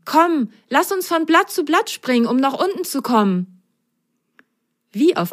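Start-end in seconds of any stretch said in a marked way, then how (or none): 0.1-3.6 high little sock describing; high childlike character voice. Acted. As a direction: tell it as an awed little sock. Character suggests idea.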